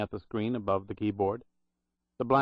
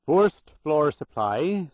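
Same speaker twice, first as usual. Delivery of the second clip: slow, loud Irish brogue, pitched up; 5 semitones higher, 6 dB louder, much slower than usual. Acted. Old Irish supply clerk answering the phone, loud Irish brogue. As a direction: boom it out in a thick Irish brogue. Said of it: "said the voice in a loud Irish brogue".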